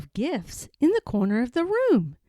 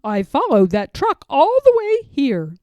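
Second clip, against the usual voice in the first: louder, eager explaining; breathless relieved man; 3 semitones higher, 8 dB louder than usual. Acted. The man with the yellow hat telling George how he found him, loud and eager. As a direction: tumble out the story of the chase.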